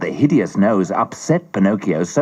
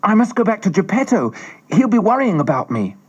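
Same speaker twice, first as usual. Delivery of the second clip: put-on much higher puppet boy, eager; Pinocchio; 10 semitones higher than usual. A narrator's reading. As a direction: hurry home as the little puppet. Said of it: "turned to the fairy and said".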